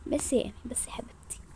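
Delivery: soft whispered shushing; calm her down in a whisper; gentle whispered hush. Acted. Hushing friend.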